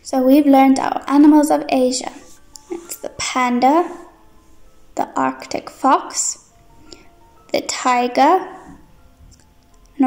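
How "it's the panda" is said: ordinary talk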